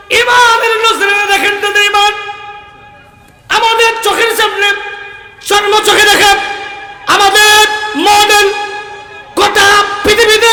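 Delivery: high, ringing declamation; full-force preaching. Acted. Preacher holds up the imam as a model, high ringing declamation. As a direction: proclaim it in separated, emphatic phrases.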